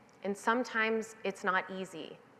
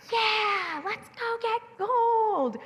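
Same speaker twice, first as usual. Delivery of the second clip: put-on much higher, slow, wide-eyed kid voice; eager little kid; 13 semitones higher, much slower than usual. Little girl answering mom about gold, high and eager. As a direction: squeal it like a six-year-old hungry for treasure. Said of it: "And I go".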